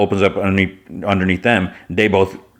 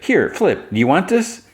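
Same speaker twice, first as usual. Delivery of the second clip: lightly acted high, offhand offer; feigned nonchalance; 9 semitones higher than usual. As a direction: pretend it is no big deal, pitched up. [name] feigns indifference, offers award.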